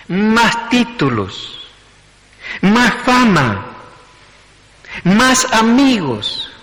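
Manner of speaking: rising, fervent sermon build, slow and emphatic; preaching build. Acted. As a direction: climb toward the peak of the sermon, then drop. Preaching build-up on human weakness.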